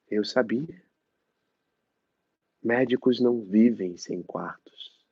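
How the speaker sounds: theatrical, mournful recital; dramatic monologue. Acted. Actor reciting the character's childhood memory, mournful. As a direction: perform the memory with longing.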